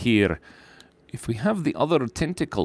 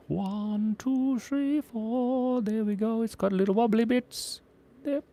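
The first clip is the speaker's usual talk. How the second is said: high soft voice; 12 semitones higher, 5 dB quieter, slower than usual. Pretend.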